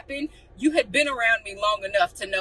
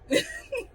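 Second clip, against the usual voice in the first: short high laugh; 4 dB quieter than usual. Real, not acted. Laugh at own anger.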